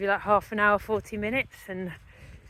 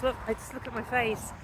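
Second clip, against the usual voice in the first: usual speaking voice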